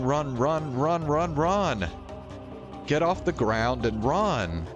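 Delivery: ordinary talk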